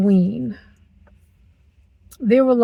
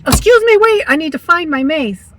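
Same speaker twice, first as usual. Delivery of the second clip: loud, high, hammed-up interruption; mock calling out; 7 semitones higher, 7 dB louder than usual. Woman pretending to stall an attacker to find mace, mock-urgent. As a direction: call out as if stalling an attacker, playfully.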